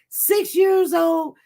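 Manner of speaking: high, slow, loud shout of the age; outraged shout. Real, not acted. To her viewers, stressing the age in outrage.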